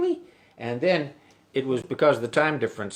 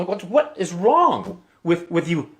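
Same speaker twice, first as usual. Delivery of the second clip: slow, higher incredulous local; baffled Irish local; 4 semitones higher than usual. Retold or imitated. Pub local quizzing an American visitor, slow mock disbelief.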